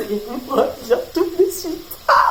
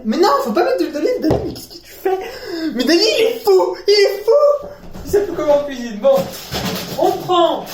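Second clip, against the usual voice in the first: half-laughing fake alarm, much higher; mock panic; 9 semitones higher than usual. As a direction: freak out at yourself, laughing. Mock panic at himself in third person.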